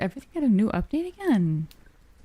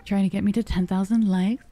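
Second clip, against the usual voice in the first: normal talking voice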